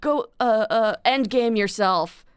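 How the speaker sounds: imitating her teenage self snapping, slowed at the end; mock snapping. Teen self snapping at a classmate in high school, mock angry. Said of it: "I would snap".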